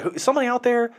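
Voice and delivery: high, nervous call; mock scared call. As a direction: call out into the dark. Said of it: "But she's like".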